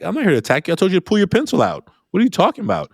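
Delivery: high-pitched retold disbelief; baffled protest. Teacher answering a student who feels attacked, baffled high protest. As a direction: pitch it well up, an incredulous teacher's retort.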